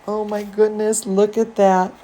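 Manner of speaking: ordinary delivery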